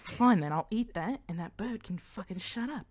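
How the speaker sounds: grumbling Australian character; Australian accent. Acted. Mania annoyed by cheese in her bag, Australian accent. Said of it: "that she turned Australian".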